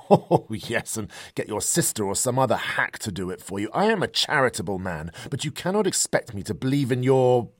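loud, high, sneering dismissal; loud mockery. Professor mocks the medium.

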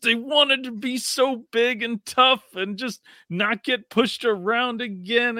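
high, slow teasing voice; high sarcastic baby-talk tone; mock tough talk. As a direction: belittle them like cute little kids. Mocking Rams again.

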